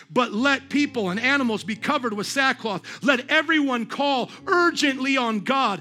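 loud, high proclamation; full-force scripture preaching. A narrator's reading. Preacher reads the king's decree to the congregation, proclaiming. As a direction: proclaim the verse, stretching the key words.

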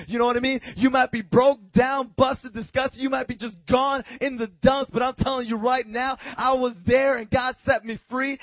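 loud, rising sermon climax; preaching at full force. Real, not acted. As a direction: preach it out to the whole room.

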